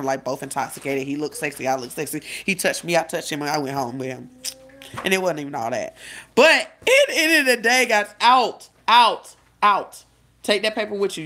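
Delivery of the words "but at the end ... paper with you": louder, higher shooing. Real, not acted. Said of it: "shooing child out of room".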